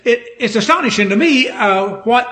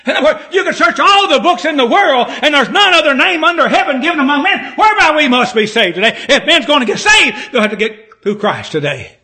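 high, insistent sermon delivery; full-force preaching; 6 semitones higher, 3 dB louder than usual. Real, not acted. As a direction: proclaim it hard to the whole congregation.

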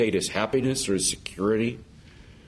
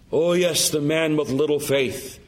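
ordinary talk

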